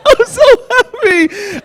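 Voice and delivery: very high, stretched blubbering; hammed-up happy crying. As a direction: squeak it out through mock sobs of joy. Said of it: "I'm crying".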